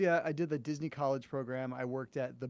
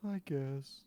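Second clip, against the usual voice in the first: sad yes; 4 dB quieter than usual. Pretend. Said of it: "Can I get a".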